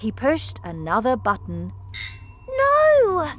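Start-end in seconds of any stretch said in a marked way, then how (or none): 2.6-3.3 very high, loud cry of protest; high-pitched yell to halt someone; shout. A narrator's reading. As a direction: shout it like an alarmed boy. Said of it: "shouted Charlie".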